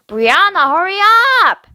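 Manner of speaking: big sister yelling through the door; shouting. A narrator's reading. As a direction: call out impatiently to a sister in the bathroom. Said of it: "I shouted through the door".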